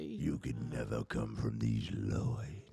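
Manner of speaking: low, very slow country voice; deep country drawl. Retold or imitated. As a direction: drop deep and stretch it slowly. Repeating the quoted line.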